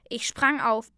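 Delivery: ordinary delivery, nothing marked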